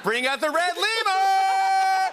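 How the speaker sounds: high, loud, long-held scream; Oprah Winfrey. Acted. Calling back the audition bit, screamed show-host impression. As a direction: stretch the last word into a screamed reveal. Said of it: "screaming lemur names in Oprah's voice".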